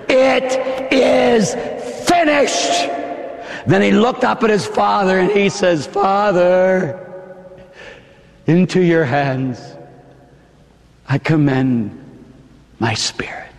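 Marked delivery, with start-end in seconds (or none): 0.1-2.9 triumphant declaration. Acted. Jesus to Satan, triumphant. 5.9-13.5 fading last words. Acted.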